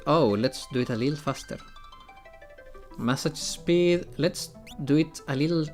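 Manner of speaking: ordinary talk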